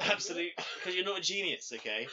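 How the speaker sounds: high, loud hammed-up cinephile rant, laughing at the end; mock film snob. Film critic mock-scolding Sam over blockbusters, hammed-up snob rant. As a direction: scold like an offended arthouse purist.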